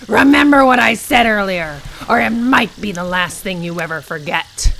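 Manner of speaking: loud, sinister warning; menacing threat. Acted. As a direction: lean in and threaten. Character issuing a threat.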